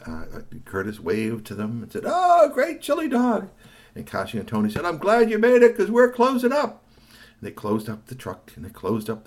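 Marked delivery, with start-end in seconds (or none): 2.1-3.5 very high, slow happy call; delighted thanks. Acted. Boy thanking the vendors, very high slow. 4.8-6.8 high, loud friendly reply; cheerful vendor. Acted. Vendors closing the truck, high loud reply.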